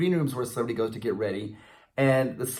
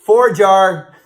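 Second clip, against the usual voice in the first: loud, higher call out; 6 semitones higher, 13 dB louder than usual. Real, not acted. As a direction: call out loudly. Calling to the dog.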